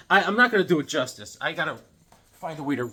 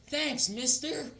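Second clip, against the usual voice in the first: high, slow and sweet; a well-mannered boy saying thanks; polite kid; 12 semitones higher, 7 dB quieter, much slower than usual. Retold or imitated. The well-raised boy thanks the old cowboy in a high, slow kid voice.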